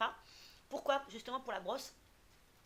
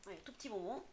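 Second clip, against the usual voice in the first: ordinary talk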